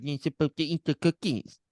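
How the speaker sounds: high-pitched, mocking parrot of her words; mocking mimicry. Rude Santa mimics the girl about cookies, mocking. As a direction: mimic her snidely in a put-on voice. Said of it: "He, like, mimics her".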